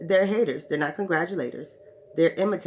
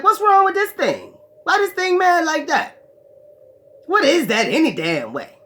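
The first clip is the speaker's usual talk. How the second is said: puzzled onlookers; 11 semitones higher, 6 dB louder than usual. Acted. Pagans puzzled by the devil.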